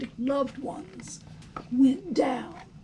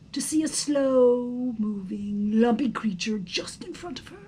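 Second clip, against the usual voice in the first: ordinary delivery, nothing marked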